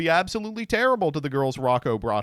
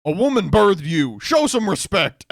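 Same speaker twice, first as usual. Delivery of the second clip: stern rebuke; 7 semitones higher, 9 dB louder than usual. Retold or imitated. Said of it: "he was like".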